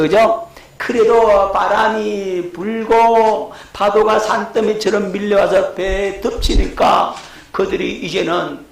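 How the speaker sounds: ordinary delivery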